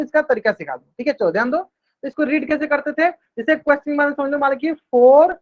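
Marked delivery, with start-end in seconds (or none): 4.9-5.3 four to the power two, drawn out slowly word by word; teacher dictating four to the power two slowly; slow reading aloud. Shown as a demonstration. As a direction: read it out slowly for the class. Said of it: "इसको दो तरीके से read करते थे".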